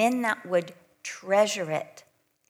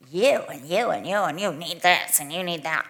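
lower, louder, jabbing accusation at each listener; finger-pointing preacher; 4 semitones lower, 5 dB louder than usual. Retold or imitated. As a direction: wag a finger at the whole room. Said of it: "I hate finger-pointing Bible studies".

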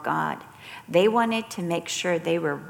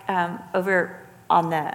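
ordinary talk

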